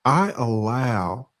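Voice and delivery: usual speaking voice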